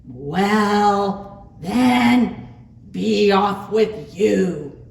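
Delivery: loud, drawn-out troll voice; loud storybook troll; gruff troll dismissal. A narrator's reading. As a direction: send the goat away loudly as the troll.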